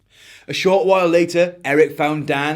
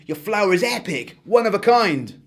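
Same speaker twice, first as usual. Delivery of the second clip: chiming brightly; 6 semitones higher than usual. Acted. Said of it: "he chimed".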